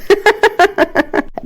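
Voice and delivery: high, quick woman's giggle; acted rippling laugh. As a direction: burst into high giggles at a confession. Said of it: "ضحكت غادة".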